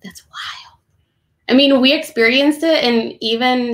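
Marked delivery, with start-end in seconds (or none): 0.0-0.8 breathy whispered disbelief; awed whisper. Real, not acted. Illustrator reacting to how long publishing took, whispered. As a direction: hush it out, amazed. Marvelling at two-year publishing timeline.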